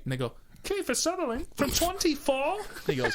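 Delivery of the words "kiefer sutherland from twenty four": high, slow starstruck question; high excited fan voice. Retold or imitated. Fan asks the actor.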